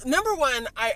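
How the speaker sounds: ordinary talk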